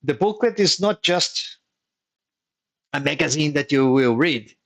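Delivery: usual speaking voice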